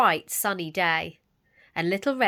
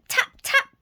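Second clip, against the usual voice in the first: high knock imitation; 6 dB louder than usual.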